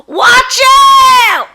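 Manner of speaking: high, loud warning call; warning shout. Acted. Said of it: "Missus Dugan called helplessly".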